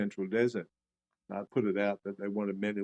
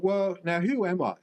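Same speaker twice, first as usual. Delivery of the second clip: high, louder bewildered question; astonished; 9 semitones higher, 5 dB louder than usual. Retold or imitated. Recalling his inner question after the course, pitched up in astonishment. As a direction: ask it as if the ground just shifted.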